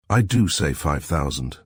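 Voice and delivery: usual speaking voice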